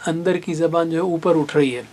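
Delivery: normal talking voice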